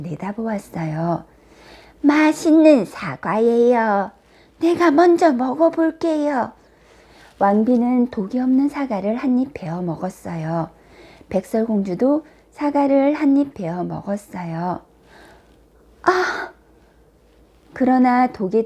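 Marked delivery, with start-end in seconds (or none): 2.0-6.5 raised, drawn-out coaxing; disguised queen's wheedling voice; old peddler woman. A narrator's reading. Disguised queen tempts with apple. 16.0-16.5 short high cry of shock; sudden storybook "ah!"; poisoned gasp. A narrator's reading. Princess bites the poisoned apple.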